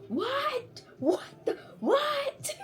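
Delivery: high, soft squeaks of disbelief; small high-pitched shocked questions; stunned disbelief. Real, not acted. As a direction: squeak it out, baffled. Stunned reaction to the book.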